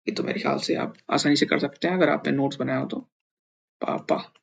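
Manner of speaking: normal talking voice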